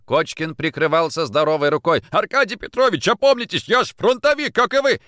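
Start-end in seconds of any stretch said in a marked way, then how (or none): 2.1-5.0 high, loud pleading protest; desperate plea. Acted. Kochkin shielding himself, desperate plea. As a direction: beg him to come to his senses. Beaten man begging boss to stop.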